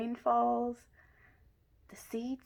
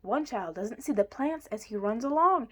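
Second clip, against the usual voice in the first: plain reading voice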